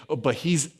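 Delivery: ordinary talk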